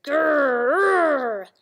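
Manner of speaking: loud drawn-out growl; tiger growl. A narrator's reading. Tigers answering Samba only with growls, loud and long. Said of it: "the tigers only answered".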